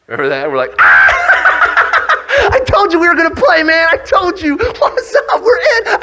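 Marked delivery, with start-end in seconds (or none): 0.8-2.5 long, high, loud squeal of joy; overjoyed scream. Acted. Players told by coach they are in, overjoyed scream. 2.5-6.0 very high, loud, squealing triumph; overjoyed shouting. Acted. Players just picked by coach to play, overjoyed shouting. Celebrating being put in.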